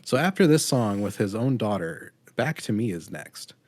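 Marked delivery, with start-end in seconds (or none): none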